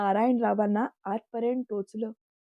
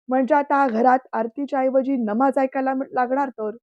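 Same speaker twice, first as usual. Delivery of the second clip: louder, sneering anger; angry; 3 semitones higher, 7 dB louder than usual. A narrator's reading. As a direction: scorn the idea with a raised voice. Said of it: "रागात बोलले".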